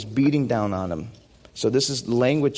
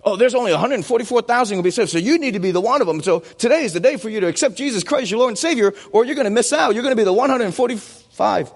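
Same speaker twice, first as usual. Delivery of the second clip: fast, higher, pushy sales-pitch parody; mock door-to-door pitch; 8 semitones higher, 3 dB louder, faster than usual. Preacher mocking a doorstep evangelist, fast and higher. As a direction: rattle it off like an eager doorstep preacher.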